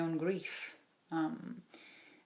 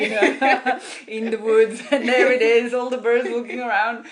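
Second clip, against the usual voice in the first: loud, higher-pitched, bubbling with laughter; tickled by your own silly comparison; laughing while talking; 5 semitones higher, 17 dB louder than usual. Real, not acted. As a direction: laugh through the words, much louder.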